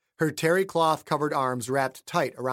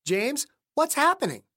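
higher wife's voice, anxious question; frightened wife; 11 semitones higher than usual. Acted. Wife asking James, frightened by the creature. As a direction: ask it scared, pitched up.

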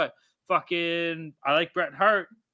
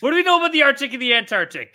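hyped restart; 7 semitones higher, 7 dB louder, faster than usual. Real, not acted. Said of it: "I know, I struggled".

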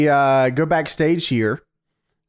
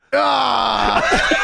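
high imitated scream; sudden high cry, put on; 9 semitones higher than usual. Host imitating what is heard in truck, high scream. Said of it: "and you hear".

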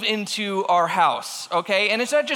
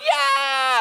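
imitated boyish cheer, pitched higher; mock-excited kid shout; excited little boys; 6 semitones higher than usual. Neighbor kids agreeing to the mouse hunt, imitated excited cheer. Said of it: "they're freaking out".

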